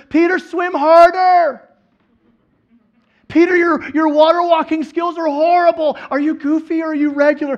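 high, very loud hectoring shout; mock calling. Jesus to sinking Peter, hammed-up mock shout. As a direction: yell a joke rebuke across the water. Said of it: "Jesus calls back to Peter".

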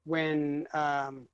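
halting, with long pauses and fillers; struggling for words. Real, not acted. Put on the spot by co-hosts, halting and unsure. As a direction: fumble along, losing the thread. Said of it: "can't get the words out".

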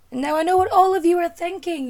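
loud, high, hammed-up announcer voice; mock dramatic announcement. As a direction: declare it like a grand proclamation to the whole audience. Mock-grand address to viewers.

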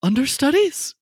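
high, worried question; nervous shopkeeper. Acted. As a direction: ask it anxiously. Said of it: "and he goes".

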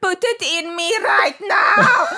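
high, loud, drawn-out South African yell; slow mock bellow in broad South African; thick South African accent. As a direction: shout it shrilly, hammed-up South African. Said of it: "someone like this and being like".

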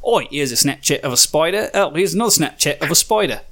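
American pretending to be Australian; fake Australian accent. Shown as a demonstration. Host repeating his impression for judging, fake Australian accent. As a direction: do a mock Aussie accent on request. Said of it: "American pretending to be Australian again".

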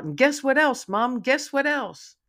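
loud, high puppy excitement; excited yell. A narrator's reading. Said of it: "Digger yells out".

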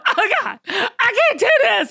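hammed-up despair, pitched high; mock despair. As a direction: whine it like you're giving up. Retold panicked call.

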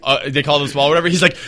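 usual speaking voice

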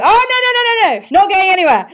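eager refusal. Retold or imitated. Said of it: "you're like".

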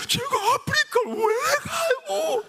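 slow, very high mock wail; Donghwan's tearful protest. Young man protests going to Africa, drawn-out mock sobbing. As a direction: drag it out in an exaggerated tearful squeal. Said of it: "막 울먹거리는 거야".

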